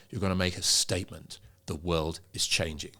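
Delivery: voice brought down. Shown as a demonstration. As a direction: bring it down low for a weighty statement. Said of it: "bring your voice back down".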